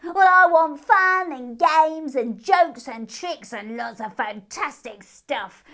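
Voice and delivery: loud, high, slow eager hare voice; bouncy hare. Acted. Moon hare demands fun while hopping up and down. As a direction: bounce with excitement. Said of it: "hopping up and down".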